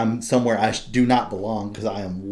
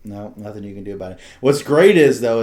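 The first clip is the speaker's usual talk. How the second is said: ordinary delivery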